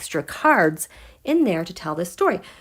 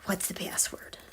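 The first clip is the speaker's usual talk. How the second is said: unvoiced hush asking the password; whispering a secret password; 2 semitones lower, 15 dB quieter than usual. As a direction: breathe the question like a secret. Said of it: "It's like".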